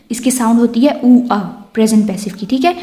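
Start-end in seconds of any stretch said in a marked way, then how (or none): none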